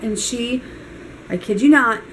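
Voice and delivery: ordinary talk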